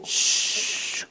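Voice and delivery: drawn-out hissed shush; soft, sustained "shhh"; long shush. Acted. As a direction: hush someone with one long breath. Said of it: "तसं ही शांडिले म्हणली".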